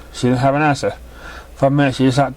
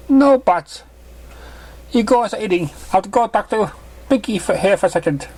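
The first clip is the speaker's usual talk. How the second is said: higher mother, firm at first; firm motherly voice; 9 semitones higher than usual. Acted. Mother telling her daughter to go eat, firm. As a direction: put your foot down gently.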